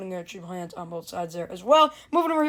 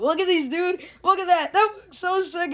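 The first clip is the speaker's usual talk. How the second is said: excited; 8 semitones higher, 3 dB louder than usual. Real, not acted. Thrilled by the accessories.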